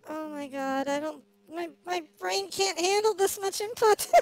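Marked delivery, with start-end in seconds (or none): none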